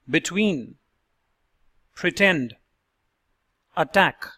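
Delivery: "Between", "pretend", "attack" with a crisp aspirated T; deliberate, separated model words; clear model words. Shown as a demonstration. Accent coach modeling the normal T for learners. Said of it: "Let's hear the second line".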